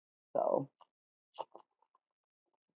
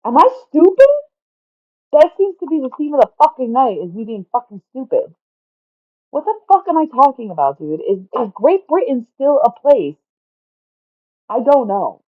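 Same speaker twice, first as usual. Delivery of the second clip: very high, loud, exasperated outburst; flustered exasperation; 10 semitones higher, 7 dB louder than usual. Real, not acted. Speaker asking silent listeners for help, loud and exasperated. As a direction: cry out in real disbelief at yourself.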